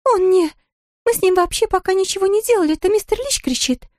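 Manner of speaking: high, hurried nurse voice; quick, high-voiced reassurance; reassuring nurse. A narrator's reading. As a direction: rattle off an explanation high-pitched. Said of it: "успокоила её сестра".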